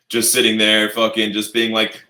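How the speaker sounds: exasperated complaint about the governors; loud exasperated rant. Real, not acted.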